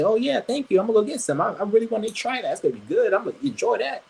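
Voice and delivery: high mocking delight of a grateful viewer; mock cheerful voice. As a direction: act like a thrilled fan going for snacks. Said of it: "They're gonna say".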